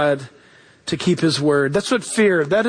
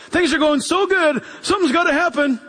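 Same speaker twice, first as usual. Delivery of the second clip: higher-pitched mock worry; imitated worrier; 6 semitones higher than usual. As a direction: lightly act out needless fretting. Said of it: "people who worry when they don't have anything".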